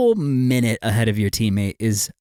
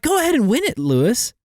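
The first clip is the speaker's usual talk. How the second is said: mock encouragement; 11 semitones higher, faster than usual. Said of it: "I'm like".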